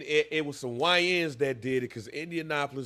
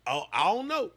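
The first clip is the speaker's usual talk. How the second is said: usual speaking voice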